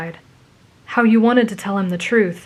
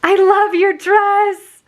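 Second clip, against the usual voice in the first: very high, loud, drawn-out squeal; 13 semitones higher, 7 dB louder, much slower than usual. A narrator's reading. Friend admires the dress, squealing with excitement. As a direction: gush slowly at piercing pitch. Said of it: "she squealed".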